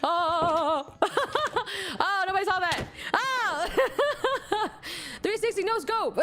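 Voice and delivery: high voice breaking into laughter; laughing hard. Real, not acted. Streamer hopes nobody saw that, laughing hard. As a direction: squeal it out between bursts of laughter. Laughing at own game mishap.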